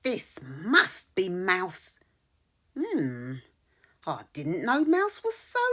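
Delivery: usual speaking voice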